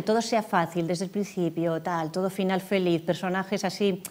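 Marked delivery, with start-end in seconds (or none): none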